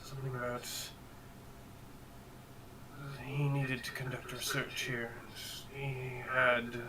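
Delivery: lightly voiced rattled villager; halting, hushed and hesitant; shaken survivor. Survivor NPC recounting the stranger.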